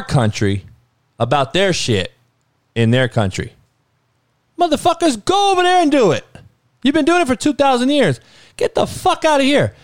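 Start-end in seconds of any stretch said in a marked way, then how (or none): 4.6-9.7 much higher, fast, fed-up yelling; exasperated outburst. Real, not acted. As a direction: throw up your hands and rant it fast. Exasperated outburst at warring countries.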